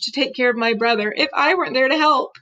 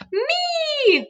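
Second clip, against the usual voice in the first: put-on much higher, a toddler's demanding shriek; screaming toddler. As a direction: squeal it like a spoiled little kid. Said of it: "Fudge started screaming".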